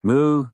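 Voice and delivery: high, loud insistent mew; cat's meow. Acted. Cat pesters the tired owner, loud high meow. Imitating the cat's cry for food.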